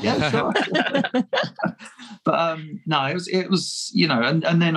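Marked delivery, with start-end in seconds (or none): 0.0-0.5 laughing reply. Retold or imitated. Brushing off fans politely. 0.6-1.7 a high burst of real laughter; high giggle. Real, not acted. Guest laughing at his own fan story, high giggle.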